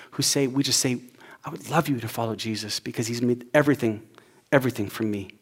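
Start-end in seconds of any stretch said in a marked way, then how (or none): 1.4-5.3 softer, lower and tender; gently invite, meaning every word; sincere invitation. Pretend. Pastor inviting a non-believer, soft and sincere.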